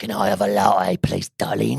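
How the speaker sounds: mock Cockney customer; Cockney accent. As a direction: order sweetly in broad Cockney. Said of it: "And a cockney accent".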